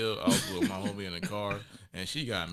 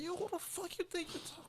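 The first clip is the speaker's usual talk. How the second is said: much higher, held-back squeak; imagined outburst; 11 dB quieter than usual. Retold or imitated. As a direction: squeak it like an outburst only in your head. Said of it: "In my head, I'm saying this".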